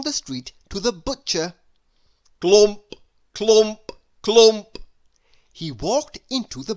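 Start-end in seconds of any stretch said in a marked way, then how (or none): 2.4-4.8 loud stomping sound words; heavy clumps. Acted. Mister Noisy walking down the hill, loud heavy clumps. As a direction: stamp each clump out loud.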